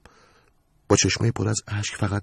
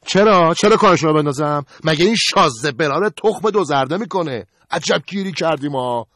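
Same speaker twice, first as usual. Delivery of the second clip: much higher and louder, indignant; indignant shout; 9 semitones higher, 7 dB louder than usual. Acted. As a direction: refuse it with outrage. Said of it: "قربانم گفت". (اعتراض بلند و خشمگین)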